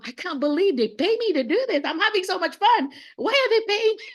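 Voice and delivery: high, loud gleeful disbelief; giddy teacher voice; joyful disbelief. Retold or imitated. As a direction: gush like you can't believe your luck.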